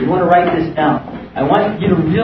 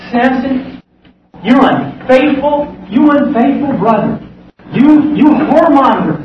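accusing; 6 semitones higher, 4 dB louder, slower than usual. Retold or imitated. Church condemns Samson.

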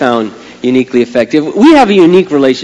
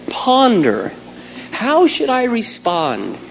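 usual speaking voice

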